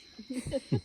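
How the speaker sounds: short real laugh at her own story; amused chuckle. Real, not acted.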